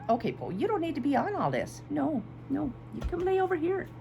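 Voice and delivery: sweet pet-talk, asking Paul to move; talking to the dog. Real, not acted. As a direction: coax the dog off the table, sweetly.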